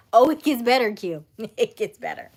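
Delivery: usual speaking voice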